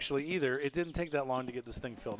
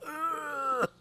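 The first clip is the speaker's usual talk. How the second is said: high strained "ugh" noise; mock groan of pain; imitated painful groan. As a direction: mimic a painful-sounding belch or groan, pitched high. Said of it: "That sounds so painful, like".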